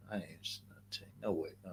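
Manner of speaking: fast breathy whispered repeat. Shown as a demonstration. Streamer whispering the refusal to his chat. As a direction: whisper it quickly to yourself. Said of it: "whispered repeat of previous line".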